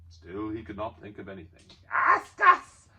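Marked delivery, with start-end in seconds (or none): 1.9-2.9 high, loud, eager creature cry; shrill creature begging; Gollum voice. A narrator's reading. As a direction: pester like a slimy cave creature. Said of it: "said Gollum".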